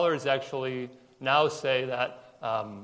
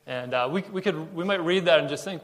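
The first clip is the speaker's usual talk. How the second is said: usual speaking voice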